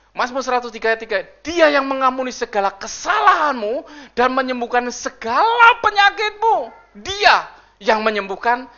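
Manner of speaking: loud, high proclaiming read; scripture reading. Acted. Pastor reading Bible verses aloud to the congregation.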